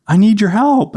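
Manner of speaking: imitated small boy, high and calling; Adam. Little boy repeating his plea to dad, high child voice. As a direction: call for help in a little-kid voice. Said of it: "And he goes".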